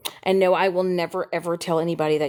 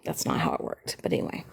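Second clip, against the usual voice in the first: muttered aside; 7 dB quieter, faster than usual. Real, not acted. Low unvoiced aside.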